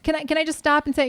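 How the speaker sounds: ordinary delivery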